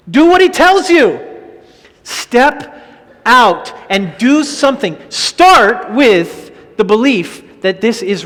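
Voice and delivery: loud, slow, high preacher's call; full-force preaching. Acted. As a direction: drive the command home to the church.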